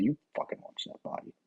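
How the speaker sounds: hiss-whisper a warning; a captain quietly warning his agents about the body; urgent whisper. Retold or imitated. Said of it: "It's kinda like".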